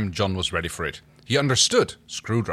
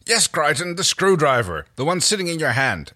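louder, higher, talking down; condescending; 7 semitones higher, 4 dB louder than usual. Acted. Erin explains the obvious to Crichton, condescendingly. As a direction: spell it out as if to someone dim. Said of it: "Erin spoke slowly, conveying in tone".

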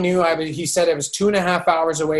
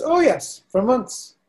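much higher, lilting knowing reply; lightly voiced serene guru; 4 semitones higher than usual. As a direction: play the teacher who saw it coming.

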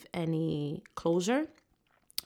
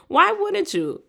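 emphatic question; 14 semitones higher, 10 dB louder than usual. Real, not acted. Rhetorical after sass.

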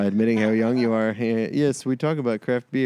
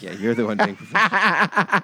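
laughing while teasing the host, a bit louder; ribbing him through a laugh; 2 semitones higher, 5 dB louder than usual. Real, not acted. Teasing the podcast host about being professional, laughing.

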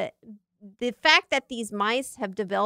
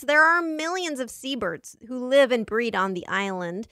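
ordinary delivery

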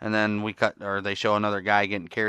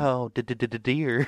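mock stuttering, softer voice; flustered stammer; 2 semitones higher, 5 dB quieter than usual. Imitating her reaction to his exit, flustered stammer. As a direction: stammer on the last word, hammed-up.

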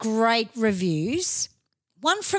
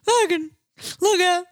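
mock scared panic; 6 semitones higher than usual. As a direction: yelp like a terrified fan running from the computer.

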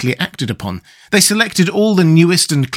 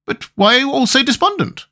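higher, curious insect voice; Wogglebug, puzzled; 11 semitones higher than usual. Acted. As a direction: ask brightly. Wogglebug asks.

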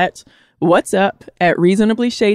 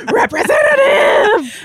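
very high, drawn-out comic yell; 4 dB louder, slower than usual. Acted. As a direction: holler it slowly, half laughing.

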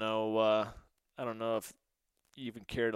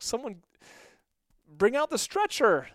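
calling out; 9 semitones higher, 6 dB louder than usual. Retold or imitated. Calling for help on field.

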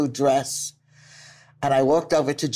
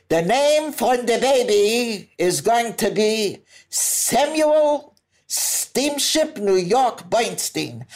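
high, slow and grand, hand on chest; proud proclamation; 8 semitones higher, much slower than usual. Retold or imitated. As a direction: announce the baby's name like a solemn decree. Grandfather proudly names the baby.

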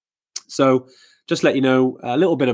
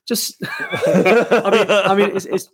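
talking through real laughter, pitched high; words broken up by laughter; laughing; 9 semitones higher, slower than usual. Real, not acted. Host reacting to hard months in travel, laughing.

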